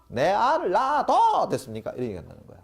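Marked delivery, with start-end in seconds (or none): 0.1-1.5 high, loud mock Gyeongsang shout, laughing; Gyeongsang dialect. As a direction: blurt it out like a Gyeongsang man proposing, high and loud. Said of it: "경상도에서 뭐라 그런다고요?".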